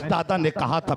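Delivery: ordinary talk, nothing marked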